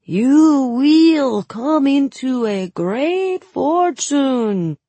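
high, loud, slow fake Suli accent; fortune-teller ham; hammed Suli accent. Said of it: "Suli accent".

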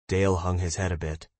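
ordinary delivery

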